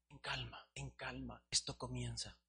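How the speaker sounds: a calm, quiet potter; soothing potter. Acted. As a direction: soothe the clay gently. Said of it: "Y el alfarero le decía".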